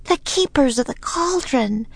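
high-pitched story character voice; voiced Annie. A narrator's reading. Annie tells Jack who the dancers are, high character voice. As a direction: lift well above the narrator for the girl. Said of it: "said Annie".

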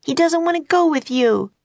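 very high pleading shout; high desperate cry. A narrator's reading. Annie screaming at Baylor, very high. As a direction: keep screaming it out. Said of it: "screamed Annie".

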